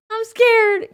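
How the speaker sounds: high, loud frightened cry; high-pitched scared yelp; scared squeal. Real, not acted. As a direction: squeak it out, really spooked.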